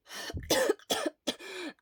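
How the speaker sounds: harsh coughing fit; hard coughing. Acted. Shura coughing after the sprint, hard coughs. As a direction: cough hard into your fist. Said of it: "coughing hard into his fist".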